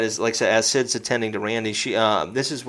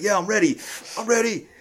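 pumping himself up; 8 semitones higher, 3 dB quieter than usual. Retold or imitated. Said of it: "he's pumping himself".

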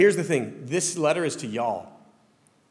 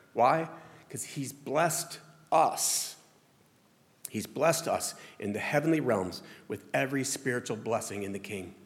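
normal talking voice

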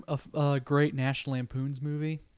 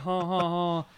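Rosie O'Donnell laugh; 3 semitones higher, slower than usual. Retold or imitated. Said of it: "those are sort of her inflections".

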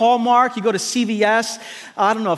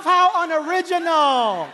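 mock disdain; 9 semitones higher than usual. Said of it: "God doesn't say".

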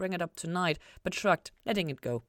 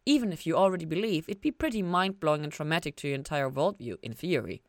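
ordinary delivery, nothing marked

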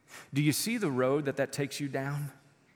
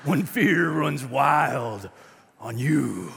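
slow pro-wrestler taunt, broken by pauses; showboating wrestler catchphrase voice; Hulk Hogan; 3 semitones lower, slower than usual. Acted. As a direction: draw out each phrase like a wrestler. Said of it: "in a Hulk Hogan vo-, voice".

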